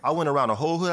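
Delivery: normal talking voice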